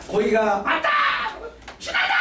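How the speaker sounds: high shouted cheer; leading a joyous chant; excited cheer. Shown as a demonstration. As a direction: shout it with joy for the congregation. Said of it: "자".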